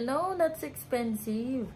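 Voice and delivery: slow, careful no; firm refusal. Retold or imitated. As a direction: spell it out slowly to the vendor. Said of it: "Sabi ko sa kanya". (mabagal at matigas na pagtanggi)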